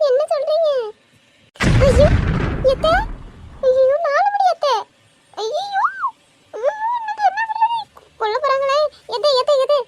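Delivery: high, teary panicked cries; panicked wail. Acted. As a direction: wail in sudden fright, voice cracking high. Caught with boyfriend, panics.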